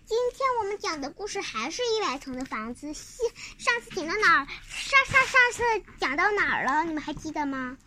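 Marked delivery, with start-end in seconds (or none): none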